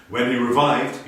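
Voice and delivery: usual speaking voice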